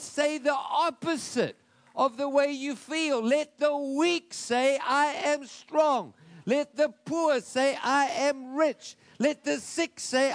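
loud, drawn-out pulpit proclamation; preaching at full force. Acted. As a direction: build it up like a sermon climax.

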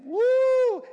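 loud, high woo; preacher's woo. Real, not acted. Preacher to congregation, loud high woo. Preacher's exclamation mid-quote.

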